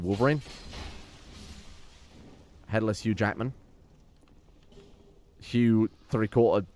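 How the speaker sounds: ordinary talk, nothing marked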